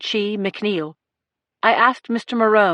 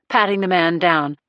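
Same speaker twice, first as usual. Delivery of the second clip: usual speaking voice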